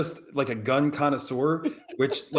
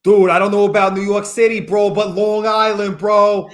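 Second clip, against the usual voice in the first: loud, higher Long Island dude voice; Long Island bro accent; 4 semitones higher, 7 dB louder than usual. Acted. Co-host teasing about New York, in a loud Long Island accent. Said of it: "Long Island talked like that".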